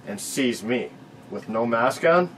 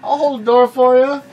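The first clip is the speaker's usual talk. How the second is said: high, sarcastic sweetness, much higher and louder; mock polite; 13 semitones higher, 10 dB louder than usual. Taunting scared shoppers with fake politeness, high and mocking. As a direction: sneer politely while holding the door. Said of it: "It's like".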